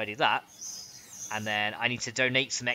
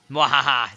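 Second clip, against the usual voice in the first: sneering panto villain; 8 dB louder, slower than usual. Pretend. Captures villager soul in bottle.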